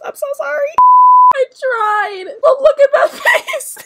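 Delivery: high, loud fangirl wail; giddy shriek; squealing apology. Real, not acted. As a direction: squeal it, half laughing.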